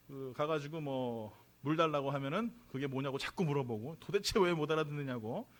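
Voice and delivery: normal talking voice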